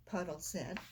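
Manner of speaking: ordinary delivery, nothing marked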